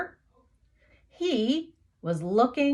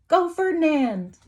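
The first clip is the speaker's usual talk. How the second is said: higher, cheering call; cheering sign; 8 semitones higher than usual. Acted. Crowd's signs cheering Ferdinand into the ring, excited high call.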